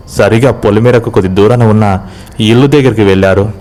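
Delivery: plain reading voice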